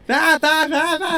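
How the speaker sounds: high nonsense syllables mocking a Boston accent; Boston babble. Cohost mocks how Boston people sound, high Boston babble. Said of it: "Everybody's more like".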